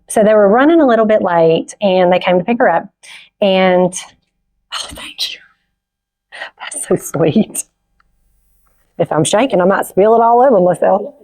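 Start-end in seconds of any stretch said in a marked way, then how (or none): 4.7-7.6 hushed, tearful whisper; real breath-caught murmur of gratitude; whispered thanks. Real, not acted. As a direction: whisper it, touched and choked up. Overcome mid-story, handed something, thanks helper.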